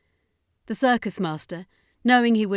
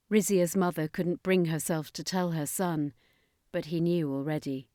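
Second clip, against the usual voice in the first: ordinary delivery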